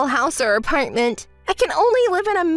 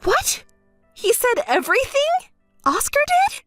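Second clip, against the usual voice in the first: high, rising questions; startled, high-pitched incredulity; alarmed disbelief; 8 semitones higher than usual. Acted. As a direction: question it in shock, pitch shooting up. Alarmed at news of a confession.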